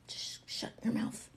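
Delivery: whispered irritation under the breath; muttered inner thought. Acted. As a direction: whisper it as a held-back retort.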